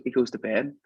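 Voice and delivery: ordinary delivery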